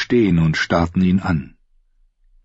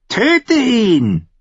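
much higher, pressing wild woman's voice; a fierce raider woman urging the king; urging Harma; 4 dB louder than usual. Acted. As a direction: push hard for the kill in a high, strange voice. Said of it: "drängte Harmer".

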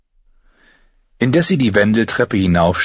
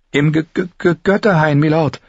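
higher, frightened stutter on the G; stammering; 8 semitones higher than usual. Acted. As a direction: stumble over the first sound out of fear. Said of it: "stammelte sie". (ängstlich stotternd, höher)

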